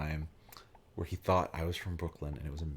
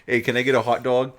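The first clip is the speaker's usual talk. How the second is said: louder, higher mock New York accent; 5 semitones higher, 9 dB louder than usual. At the dining car counter, faking a New York accent. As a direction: order like a local New Yorker. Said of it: "I wanna order one like that".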